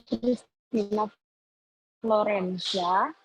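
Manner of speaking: choppy connection dropping out; broken-up call audio; corrupted glitchy audio. Real, not acted. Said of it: "Suaranya putus-putus, Kak". (suara putus-putus)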